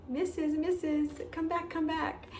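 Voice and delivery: calling out high and urgent; mock call to come back; imitating a yell. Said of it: "yelled at me and said".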